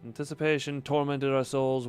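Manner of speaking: ordinary delivery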